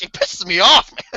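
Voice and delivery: ordinary delivery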